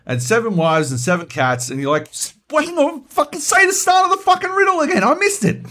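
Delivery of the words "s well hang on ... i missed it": high, loud comic panic; exasperated yell. Acted. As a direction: yell it out like a flustered player. Acting out frustrated player missing riddle.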